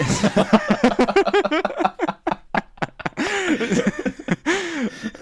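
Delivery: long high-pitched laughing fit. Real, not acted. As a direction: collapse into helpless high laughter. Cracks up at own dog name. (langer, hoher Lachkrampf)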